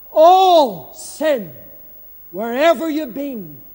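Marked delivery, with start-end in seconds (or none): 0.1-1.5 "All" hit very loud; stress on All. Real, not acted. Preacher insisting every sin is cleansed, heavy stress on "All". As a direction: lean hard and long on "All". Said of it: "I put emphasis on the word all".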